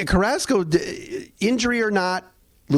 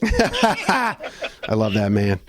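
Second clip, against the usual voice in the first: laughing the words out slowly, delighted; fond, laughing praise for the broadcaster; much slower than usual. Real, not acted. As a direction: let the laugh carry the line. Laughing at cohost's joke.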